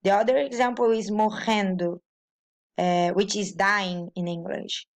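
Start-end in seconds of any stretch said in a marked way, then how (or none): 1.1-2.0 morrendo with RR as a throaty H; Portuguese double R. Shown as a demonstration. Teacher giving an example, morrendo's double R like H. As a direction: say morrendo like "mo-hen-do". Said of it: "sound is like the R in the beginning".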